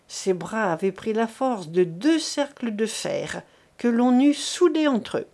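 plain reading voice

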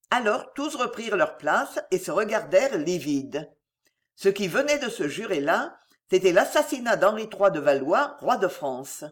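ordinary delivery

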